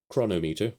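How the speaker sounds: Chronometer as CROH-no-MEE-ter, main stress on MEE; trial pronunciation. Shown as a demonstration. Vlogger trying out an app's name, trial pronunciation of Chronometer as chrono-MEE-ter. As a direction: sound out Chronometer as "chrono" plus "meter". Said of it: "the way that you would pronounce it".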